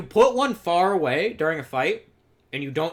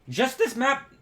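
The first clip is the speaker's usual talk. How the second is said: real accidental crack on a high pitch; 8 semitones higher than usual. Real, not acted. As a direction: voice breaking as it jumps high. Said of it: "voice crack".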